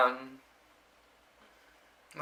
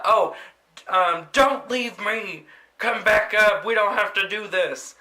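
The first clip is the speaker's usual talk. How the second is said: mock desperate pleading; 6 semitones higher, 3 dB louder than usual. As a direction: beg the poop to come back. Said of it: "then he just started screaming".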